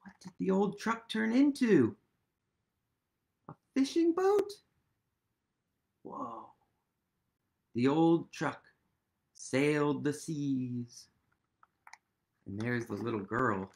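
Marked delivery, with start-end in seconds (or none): none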